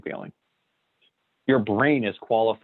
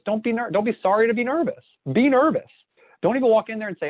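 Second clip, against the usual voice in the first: much higher and louder, insistent; fired-up pep talk; emphatic urging; 11 semitones higher, 3 dB louder, faster than usual. Real, not acted. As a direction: push the point hard. Urging listener not to apologize.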